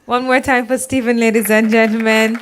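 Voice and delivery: host calling on the crowd; rally the room for the speaker; announcer call-out. Acted. Host to audience, hyped announcer call.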